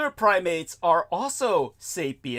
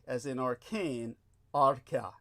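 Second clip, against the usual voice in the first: ordinary delivery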